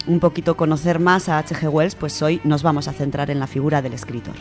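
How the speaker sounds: ordinary talk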